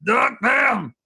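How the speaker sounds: high drawn-out parody shout; mock rallying yell. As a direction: call it like the coach's war cry.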